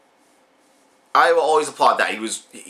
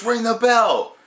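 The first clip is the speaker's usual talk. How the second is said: calling the bell; 9 semitones higher, slower than usual. Retold or imitated. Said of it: "rolls up and goes".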